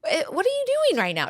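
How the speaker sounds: high, fast disbelieving question; incredulous. Retold or imitated. Mom catching her daughter lying, incredulous. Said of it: "I'm like".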